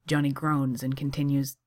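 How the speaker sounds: ordinary delivery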